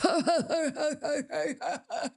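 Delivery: high mock chortle; imitated laughter. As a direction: mimic an obnoxious moviegoer's laugh. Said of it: "laughing. Almost every time".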